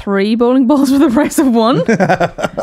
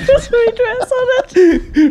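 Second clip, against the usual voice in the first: laughing; 12 semitones higher, slower than usual. Real, not acted. Cracking up at ball bag.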